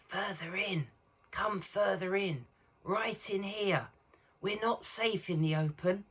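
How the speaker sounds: high, soft, creaturely whisper; hoarse throaty whisper. A narrator's reading. Beaver leading the children in, hoarse throaty whisper. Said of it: "in a hoarse, throaty whisper".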